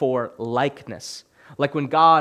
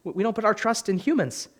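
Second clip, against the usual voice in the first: higher, fast, half-mocking disbelief; incredulous mock question; 6 semitones higher, 4 dB quieter, faster than usual. Preacher mocks Christians panicking at election time, quick incredulous higher voice. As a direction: lightly act out baffled disbelief at worried believers. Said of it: "And I'm like".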